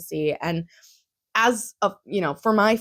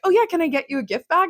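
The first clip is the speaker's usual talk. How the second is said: cheery seller voice; 8 semitones higher, faster than usual. Retold or imitated. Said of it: "I was like".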